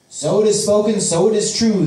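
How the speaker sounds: high, loud, eager proclamation; energetic agreement. A narrator's reading. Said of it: "the man energetically agrees".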